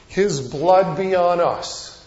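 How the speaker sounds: loud, slow crowd proclamation. Retold or imitated. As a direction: slowly declare it as the whole crowd. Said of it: "the people as a whole answered".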